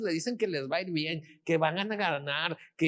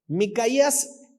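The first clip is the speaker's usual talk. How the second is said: normal talking voice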